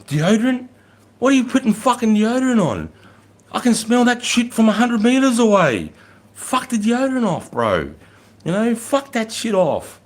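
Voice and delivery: high, loud, outraged retold rant; sergeant's dressing-down. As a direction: bawl them out in disbelief. Acted sergeant dressing down a lieutenant.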